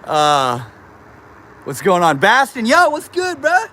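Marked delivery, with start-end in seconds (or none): 2.2-3.7 a much higher, hyped greeting; excited greeting. Real, not acted. Streamer greets viewer Bastin, hyped high greeting.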